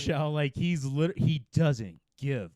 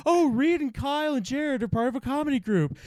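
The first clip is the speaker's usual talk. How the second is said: higher mock voice of a friend; mock visiting friend; 12 semitones higher, 4 dB louder than usual. Friend visiting from outside Steamboat, higher mock voice. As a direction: play an outsider sneering at the group.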